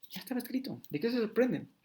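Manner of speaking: voz aguda de Jesús. Acted. Jesus voiced high.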